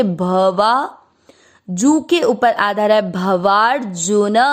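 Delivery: ordinary talk, nothing marked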